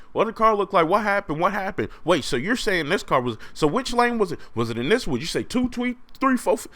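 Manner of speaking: high, rapid mock cop questions; high hammed-up officers grilling him; cops questioning. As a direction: pepper him with questions in a squeaky voice.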